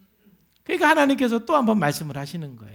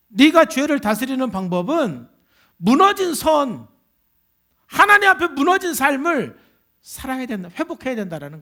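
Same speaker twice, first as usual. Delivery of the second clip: forceful preacher's voice, raised and insistent; impassioned preaching; 3 semitones higher, 5 dB louder than usual. Acted. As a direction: hammer the key phrases home.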